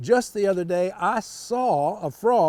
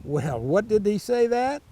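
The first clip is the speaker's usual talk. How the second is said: plain reading voice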